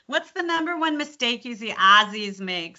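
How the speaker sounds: imitated Chicago girl's twang; Chicago accent. Coach imitating Chicago girls, twangy Chicago accent. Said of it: "So they would say Aussies make".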